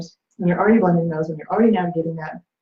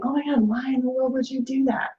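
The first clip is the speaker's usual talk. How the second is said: higher-pitched, lightly acted disbelief; mock exasperated outburst; 6 semitones higher, 4 dB quieter than usual. Partner scolding the other over telling relatives, mock exasperated. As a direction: play an aghast accusation without raising the volume. Contrast example of blaming reaction.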